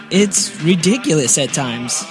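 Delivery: ordinary delivery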